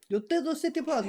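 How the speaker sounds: high-pitched mock movie villain; mocking imitation. Mimicking the film villain's line.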